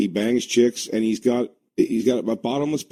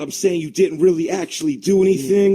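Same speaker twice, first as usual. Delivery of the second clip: lightly indignant grown son, higher and louder; mock arguing; 7 semitones higher, 5 dB louder than usual. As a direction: press the complaint in a pretend dispute. Acted son suing his dad.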